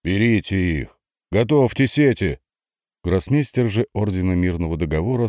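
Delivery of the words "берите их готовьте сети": haughty, raised order; haughty command. A narrator's reading. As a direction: bark it out haughtily. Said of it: "бросил надменно".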